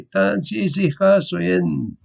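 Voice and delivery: Tan si si ka so yin, each syllable spoken out; Michif greeting. Shown as a demonstration. Teacher showing students how to greet in Michif, clearly. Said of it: "And you'll say".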